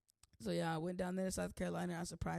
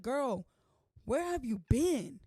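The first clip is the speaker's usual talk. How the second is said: higher, louder, slow "where have you been"; mock viewer voice; 3 semitones higher, 5 dB louder, slower than usual. Viewers teasing the absent vlogger in a mock voice. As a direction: play a fan calling her out. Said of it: "I know y'all probably like".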